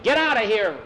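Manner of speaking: high, urgent yell; acted yell. A narrator's reading. Nikolai warns Hugo away, urgent yell. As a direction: yell a warning, pitch jumping up. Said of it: "Nikolai yelled".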